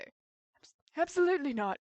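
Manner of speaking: put-on Australian accent. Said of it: "she's got an Australian accent".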